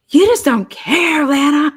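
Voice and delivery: loud, high, slow complaint; exasperated accusation. Retold or imitated. Partner scolding Lana over the trash, loud and exasperated. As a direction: throw the accusation at her, exasperated.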